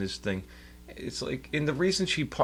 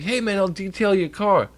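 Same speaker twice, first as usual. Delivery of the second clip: hustler pitch; 10 semitones higher, 5 dB louder than usual. Retold or imitated. Voicing pushy parking lot hustler.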